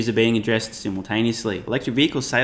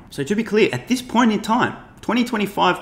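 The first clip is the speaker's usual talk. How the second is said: emphatic; 10 semitones higher than usual. Real, not acted. Emphatic point about 2025.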